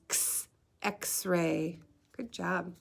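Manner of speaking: ordinary talk, nothing marked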